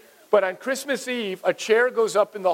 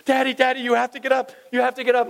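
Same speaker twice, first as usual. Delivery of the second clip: put-on higher, insistent kid voice; mock childlike urgent calling; pleading children; 5 semitones higher, faster than usual. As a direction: imitate kids pestering their dad awake. Acts out children waking father.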